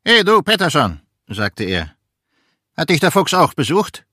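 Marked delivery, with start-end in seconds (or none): 0.0-1.0 loud, higher-pitched gruff hail; grim, loud call across the yard; grim neighbour. A narrator's reading. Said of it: "Er sah ziemlich grimmig aus". 2.8-4.1 loud, higher-pitched questioning; grim, forceful question; grim neighbour. A narrator's reading. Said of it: "Er sah ziemlich grimmig aus".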